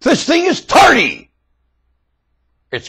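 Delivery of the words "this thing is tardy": high, slow, louder scold; mock scolding. Preacher scolding the tardy world, slow and high. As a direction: scold it slowly, pitched up.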